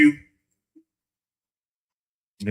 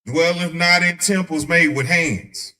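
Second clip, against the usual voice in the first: scripture reading; 5 dB louder, slower than usual. Acted. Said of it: "reading scripture".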